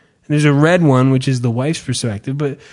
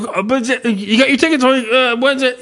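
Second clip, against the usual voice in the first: confused visitor; 7 semitones higher than usual. Retold or imitated. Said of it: "We're like".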